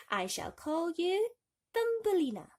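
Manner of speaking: name her with wonder; delighted mother. Acted. The wife naming the tiny girl, high and delighted. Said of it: "said the woman".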